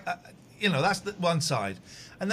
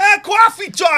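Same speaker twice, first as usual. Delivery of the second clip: loud, higher mock-angry greeting; mock hostile shout; 13 semitones higher, 12 dB louder, slower than usual. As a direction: yell his name in fake outrage.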